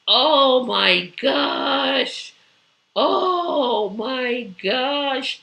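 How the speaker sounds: long, drawn-out oh my gosh; exasperated groan. Real, not acted. Streamer venting at the administration, drawn-out exasperation. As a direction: stretch it out in weary disbelief.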